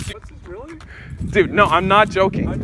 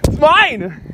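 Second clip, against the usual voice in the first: high insistent it's mine; possessive whining; 9 semitones higher, 3 dB louder than usual. Real, not acted. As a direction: whine that it's yours.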